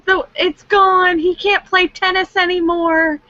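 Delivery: high, hammed-up doom, laughing through it; mock despair. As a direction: wail that he's finished, half joking. Mocking fans' doom.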